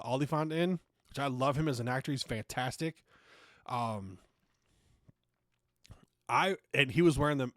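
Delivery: usual speaking voice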